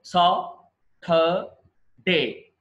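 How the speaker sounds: Saturday stretched very slowly into sa, tur, day; slow syllable demo. Shown as a demonstration. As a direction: spell out Saturday in slow beats. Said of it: "ఇది చూడండి". (నెమ్మదిగా అక్షరాలుగా)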